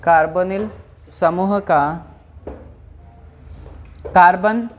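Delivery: slow, word-by-word dictation with long pauses; classroom dictation. Acted. Teacher reading out notes for students to write, measured dictation. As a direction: dictate in small, evenly stretched chunks.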